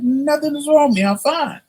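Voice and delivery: mock-indignant protest, high; insistent high denial. Telling his sister he's fine, high and insistent. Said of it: "I said".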